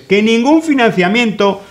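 mocking quote. Quoting the editor José Hassan Nieves, mocking and laughing. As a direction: laugh while mimicking the editor's claim. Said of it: "nos dice el Hassan este".

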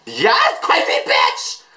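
high, harsh hyped-up cry; drawn-out fan scream; excited cheering. Real, not acted. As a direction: cheer the singer on wildly. Cheering on the music video.

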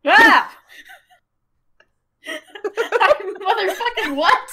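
loud, laughing disbelief; shocked yell. Real, not acted. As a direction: shout in delighted shock. Suddenly under Fly spell.

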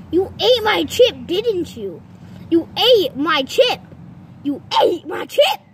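loud accusing, punching ate; hammed-up angry accusation. Accusing friend of eating chip.

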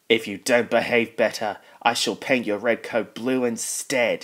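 loud, firm scolding; stern. A narrator's reading. Fat Controller threatening to repaint misbehaving James, stern. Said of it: "he said sternly".

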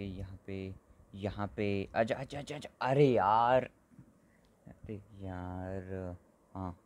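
ordinary delivery

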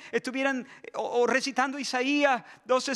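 normal talking voice

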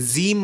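"জিম" said as buzzy "zim"; wrong z-sound "জিম"; common mispronunciation. Shown as a demonstration. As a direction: turn the j of "জিম" into a z. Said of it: "অনেকে জিম বলে".

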